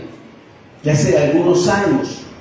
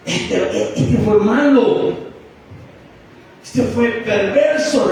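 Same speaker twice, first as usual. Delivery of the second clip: higher, slow disdainful judgment; scornful gossip; 6 semitones higher, slower than usual. Retold or imitated. Neighbours condemning his past, slow and scornful. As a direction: drag out their contempt.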